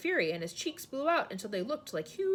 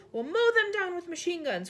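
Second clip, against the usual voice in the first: high loud military cry; army chief crying out loudly; cried out; 9 semitones higher, 8 dB louder than usual. A narrator's reading. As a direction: yell it, eager for a fight. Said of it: "cried the head of the army".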